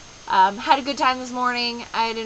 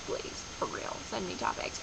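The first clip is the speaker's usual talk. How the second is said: whispered plea; 4 semitones lower, 11 dB quieter than usual. Real, not acted. Pleading to viewers.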